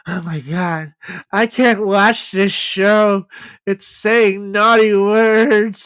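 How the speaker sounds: put-on high, slow, whining child voice, a touch overdone; mock a scandalised little boy dragging every word; whiny little kid. Friend mocks girlfriend's little brother, in a slow high whine.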